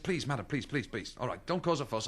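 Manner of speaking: hushed pleading. Acted. Salesman begs the shouting customer to calm down, hushed. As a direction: beg her quietly not to make a scene.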